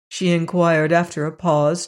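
ordinary delivery, nothing marked

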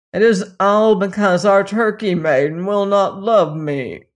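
loud, put-on voice of a thwarted prince; spiteful prince. A narrator's reading. Wicked prince complaining to his father, spiteful. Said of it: "cried and howled with rage and spite".

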